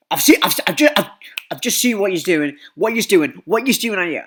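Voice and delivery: high, loud and stumbling over the words; a worked-up adult who can't get it out; agitated counsellor. Retold or imitated. Counsellor telling the others he caught them, high flustered rush. Counsellor reporting what he saw.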